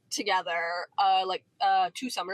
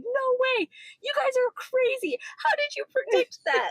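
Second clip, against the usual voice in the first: much higher, excited disbelief; freaking out; 14 semitones higher than usual. Retold or imitated. Coworker John freaking out over the prediction, high excited squeal. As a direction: squeal it like you can't believe it.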